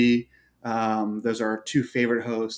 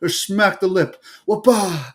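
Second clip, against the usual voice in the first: higher-pitched surfer dude; 7 semitones higher, 4 dB louder, slower than usual. Retold or imitated. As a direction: imitate the old surfer meme. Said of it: "like, dude".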